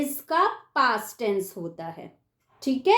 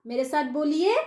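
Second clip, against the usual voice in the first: ordinary delivery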